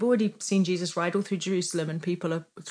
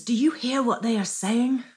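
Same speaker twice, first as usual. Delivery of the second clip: higher-pitched, imitated indignant demand; mock indignant yell; 4 semitones higher, 4 dB louder than usual. Priests yell at Jesus about the children, imitated indignation. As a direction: challenge him like an offended priest, half-yelled. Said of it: "they yelled at Jesus".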